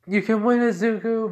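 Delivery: high, loud cheer. Retold or imitated. Family roots for a contestant, cheering.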